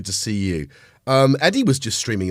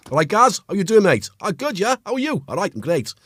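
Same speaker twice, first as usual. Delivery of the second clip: put-on high, lively Wrexham accent; playful, raised-pitch Wrexham banter; 9 semitones higher, 5 dB louder than usual. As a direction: act out mates greeting in Wrexham accent. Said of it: "what people talk like from Wrexham".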